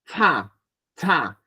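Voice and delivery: CHA with air through mouth and nose; nasalised "Cha" syllable; nasal cha. Shown as a demonstration. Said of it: "Es nasal".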